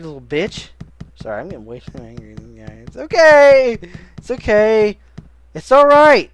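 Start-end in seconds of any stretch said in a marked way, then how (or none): 2.9-6.3 high, loud mock comfort; loud reassuring shout. Real, not acted. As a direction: yell reassurance at the tired character. Shouting comfort at game character.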